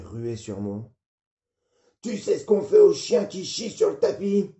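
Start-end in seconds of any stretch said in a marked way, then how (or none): 2.0-4.5 furious high bellow of a father; father yelling. Acted. Father yelling at his drunk son at the door.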